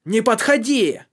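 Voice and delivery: high, loud warning yell; a boy's frantic yell; shouting back. Acted. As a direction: shout to keep them away. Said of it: "закричал он в ответ".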